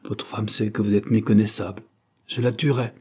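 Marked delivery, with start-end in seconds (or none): none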